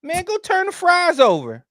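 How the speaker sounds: high, fast, exasperated scolding; irritated boss. Acted. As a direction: snap at him like a fed-up manager.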